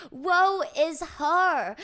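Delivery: slow, loud, hammed-up lament; mock tragic. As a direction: drag it out like a tragic play. Dramatic storytelling aside.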